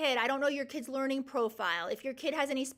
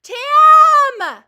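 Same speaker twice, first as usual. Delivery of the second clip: a delighted, much higher and louder cry of her name; excited shout; 13 semitones higher, 22 dB louder than usual. Real, not acted. Greeting a friend in live chat.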